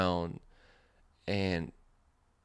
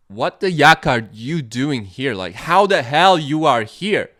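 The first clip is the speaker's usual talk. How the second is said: loud, raised disbelieving outburst; shocked friend; 6 semitones higher, 12 dB louder than usual. Retold or imitated. Best friend yelling in shock at him arriving.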